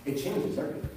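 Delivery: soft, low and solemn; hushed emphasis. Real, not acted. Preacher landing the key claim on listeners, hushed and solemn. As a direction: drop down low for weight. Solemn repeated climax of sermon point.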